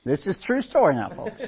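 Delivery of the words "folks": normal talking voice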